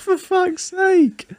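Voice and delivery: high, very drawn-out exasperation; exasperated groan. Real, not acted. Co-host reacts to his mates' dark joke, high exasperated groan. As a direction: stretch the curse into a high wail.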